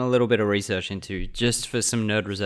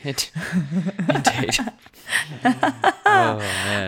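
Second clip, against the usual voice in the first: much higher, laughing hard; 11 semitones higher, much slower than usual. Real, not acted. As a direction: crack up through the words. Laughing fit.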